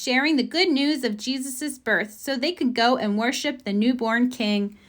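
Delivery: ordinary delivery, nothing marked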